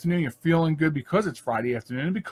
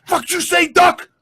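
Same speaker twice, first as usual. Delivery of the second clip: retold high, loud tough-guy shout at the geese; tough guy yelling; 8 dB louder than usual. A passerby yelling at a family of geese, mock tough-guy shout. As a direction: bark it at the birds like a street tough. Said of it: "really tough guy thing every time he says something".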